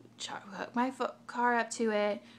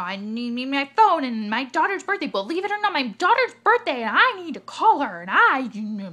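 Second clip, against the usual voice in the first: higher, louder begging; desperate plea; 6 semitones higher, 11 dB louder than usual. Retold or imitated. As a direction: explain about the daughter's birthday, pleading. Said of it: "looking at her like".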